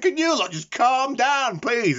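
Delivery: high, slow, scolding nurse voice; exasperated nurse. Retold or imitated. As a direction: play a fed-up nurse telling them off. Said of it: "The nurses are going".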